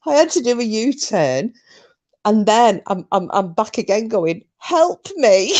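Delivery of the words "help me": high, pleading whine; a put-on whiny plea. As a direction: whine for help like a child. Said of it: "I'm trying to put a whiny voice on".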